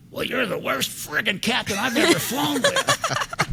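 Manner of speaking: high, loud, drawn-out mock insult; indignant old lady. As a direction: play an outraged old passenger, every word stretched. Old lady insults captain, acted.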